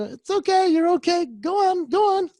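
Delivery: sing-song it's okay to a pup; soothing a dog. Retold or imitated. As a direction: reassure the dog and shoo it along kindly.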